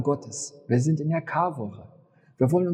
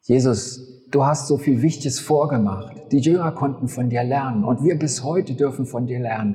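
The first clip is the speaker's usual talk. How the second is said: earnest spoken prayer to Jesus; 3 semitones lower, 4 dB louder than usual. Real, not acted. Pastor closing the sermon in prayer to Jesus, earnest. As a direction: address Jesus directly, earnest and devoted. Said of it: "Ich bete".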